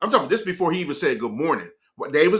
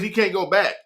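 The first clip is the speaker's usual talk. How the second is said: ordinary talk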